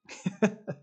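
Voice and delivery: short laugh. Real, not acted. Laughing at his own analogy.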